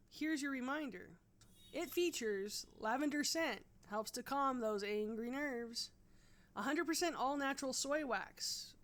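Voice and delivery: loud, punchy ad-read voice; hammed-up commercial announcer hyping the product; infomercial announcer. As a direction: sell it like a cheesy TV pitchman.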